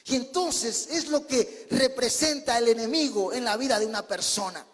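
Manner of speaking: ordinary delivery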